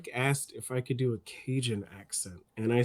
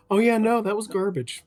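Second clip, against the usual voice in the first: high, loud dismissive outburst; blunt mock verdict; 9 semitones higher, 5 dB louder than usual. External filter telling the voice actor off, mock blunt verdict. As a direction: act out a frank critic trashing a take.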